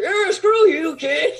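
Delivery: high, loud jeering brush-off; mock the kid with a loud high sneer; mock dismissive cast. Cast members brushing off the eager young actor, high loud sneer. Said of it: "and everyone's like".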